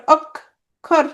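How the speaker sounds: অক্ষর in two beats, অক, then খর; split into syllables. Shown as a demonstration. Teacher showing the two syllables of অক্ষর, split apart. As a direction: pause in the middle of অক্ষর. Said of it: "দুইটা অক্ষর".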